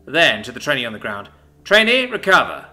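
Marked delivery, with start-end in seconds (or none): 1.6-2.7 loud, high commands; barked orders. Acted. Sergeant ordering trainee up to help Adam, loud. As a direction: bark them one after another.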